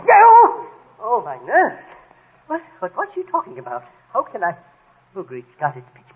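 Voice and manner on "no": high, loud outburst; exasperated high shout; yell. Acted. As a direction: yell as your nerves give way. Nerves give way, yelling.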